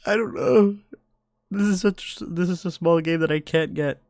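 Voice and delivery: high, loud exasperated whine; loud high-pitched frustration; frustrated outburst. Real, not acted. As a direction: vent at the game in a high strained voice.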